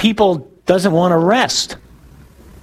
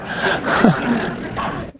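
short laugh; 7 semitones higher, 5 dB quieter than usual. Real, not acted. Laughs at Ann Arbor jab.

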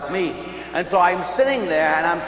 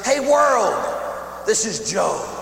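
announcing; 6 semitones higher, slower than usual. Retold or imitated. Pope announcing Joe to world.

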